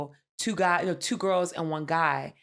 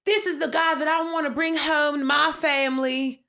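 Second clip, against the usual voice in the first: mock earnest contestant; 8 semitones higher, 5 dB louder than usual.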